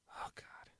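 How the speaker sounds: breathy whispered oh God; whispered dread. Real, not acted. Host dreading football turning into flag football, whispering. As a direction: drop to a whisper in mock horror.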